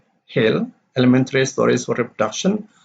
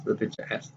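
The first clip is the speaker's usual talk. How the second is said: "The teacher asked" low-key and much softer; unstressed tag; 3 semitones lower, 13 dB quieter, faster than usual. Shown as a demonstration. Teacher reading a story's reporting clause, unstressed. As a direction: drop "The teacher asked" away, quiet and unstressed. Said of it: "The teacher asked here is not".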